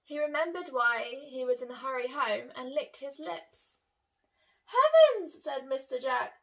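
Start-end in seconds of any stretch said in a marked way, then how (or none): 4.7-5.4 high, loud exclamation; much higher, louder cry; surprised. Acted. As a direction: burst out in surprise. Jack's exclamation.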